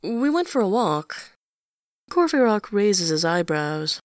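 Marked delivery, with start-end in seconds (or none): 0.0-1.4 quick blurt, a touch higher. A narrator's reading. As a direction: blurt it out before he can stop himself. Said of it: "Enjolras blurts".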